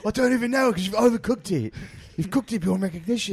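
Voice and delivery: high, ranting chef voice; furious chef. Retold or imitated. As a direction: tear into the cook. Ramsay voice continued.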